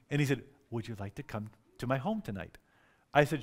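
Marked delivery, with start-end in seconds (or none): 0.7-2.6 hushed imitation of a shy asker; soft, lowered, timid invitation; way softer. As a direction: barely venture the question, very softly. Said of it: "he said it way softer than that".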